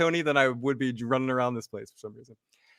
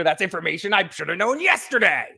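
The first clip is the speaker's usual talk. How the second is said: Adam Sandler line; 5 semitones higher than usual. Retold or imitated. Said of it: "does the Adam Sandler from The Wedding Singer".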